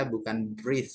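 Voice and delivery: ordinary delivery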